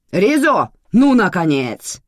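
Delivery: loud, high, impatient yell; shouting. A narrator's reading. Manana greets Rizo's arrival, still shouting. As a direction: holler at him for being late. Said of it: "продолжала кричать Манана".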